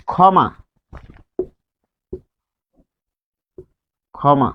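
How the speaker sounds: "ক্ষমা" said khɔma, opening on plain kh; initial kh sound. Shown as a demonstration. Teacher gives example of word-initial rule, "ক্ষমা" as khɔma. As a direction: start "ক্ষমা" with a single aspirated kh. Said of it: "শুরুতে খ এর মতো".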